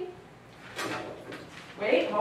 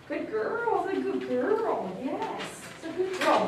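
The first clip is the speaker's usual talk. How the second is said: high sweet dog praise; 6 semitones higher, 5 dB quieter than usual. Real, not acted. Groomer cooing over the dog after a hug, high soft praise. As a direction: coo at her like a proud owner.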